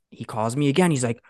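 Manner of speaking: normal talking voice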